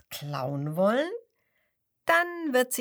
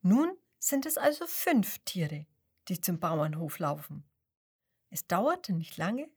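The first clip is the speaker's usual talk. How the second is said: ordinary delivery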